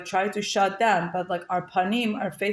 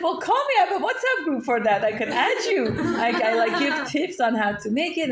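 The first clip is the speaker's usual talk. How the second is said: bubbly, laughing, much higher voice; eager laughing offer; 5 semitones higher than usual. Retold or imitated. Retelling her offer to the soldier's wife, excited and laughing. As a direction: gush out the invitation through laughter.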